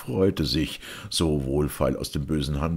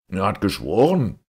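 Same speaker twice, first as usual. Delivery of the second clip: louder, raised-pitch worried reply; fearful; 4 semitones higher, 6 dB louder than usual. A narrator's reading. Police judge defending himself to the Caliph, fearful. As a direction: answer up with a touch of fear. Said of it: "antwortete der Polizeirichter furchtsam".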